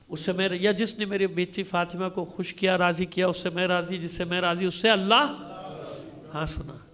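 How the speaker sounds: ordinary talk, nothing marked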